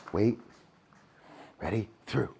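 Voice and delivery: ordinary talk, nothing marked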